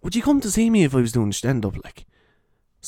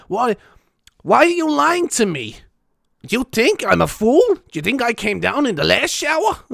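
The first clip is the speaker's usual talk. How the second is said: higher, stern TV judge scolding the wind; mock Judge Judy; 11 semitones higher than usual. Scolding the wind as Judge Judy, mock stern. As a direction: put the wind on trial, high and indignant. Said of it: "have Judge Judy there at the wind being like".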